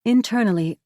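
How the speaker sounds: usual speaking voice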